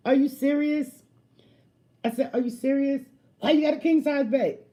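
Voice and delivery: high, loud disbelief; incredulous. Retold or imitated. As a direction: throw his question back at him, loud and high. Mocking his question.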